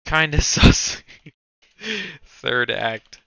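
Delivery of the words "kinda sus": a laugh breaks through, louder and higher. Real, not acted. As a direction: giggle out the verdict. Laughing at a movie.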